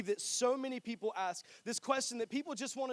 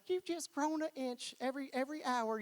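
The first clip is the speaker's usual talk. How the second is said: higher, a lightly voiced grandma; grandmother impression; 5 semitones higher than usual. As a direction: coo like a doting grandma. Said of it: "my grandmother who's like".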